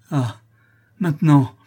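high, loud outburst; crying out. A narrator's reading. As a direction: exclaim with sudden force. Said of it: "s'écria Louise".

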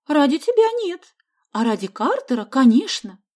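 high, loud curt retort. A narrator's reading. Sonya teases boy about Carter, curtly. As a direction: toss it off curtly, high-voiced. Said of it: "коротко бросила Соня".

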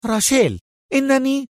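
a boss losing her temper; angry cry. Acted. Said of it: "صاحت سونيا في غضب". (صيحة غاضبة)

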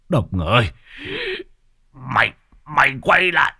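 rising to loud high yells; cursing shout. Acted. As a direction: curse the vanishing car, wailing his loss. Lão Hói vẫn ngoạc mồm ra chửi. (gào chửi theo xe)

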